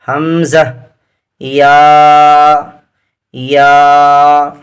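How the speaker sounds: each letter name called out and held; teacher's chanting drill; alphabet recitation. Shown as a demonstration. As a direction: recite the letters slowly for the class to repeat.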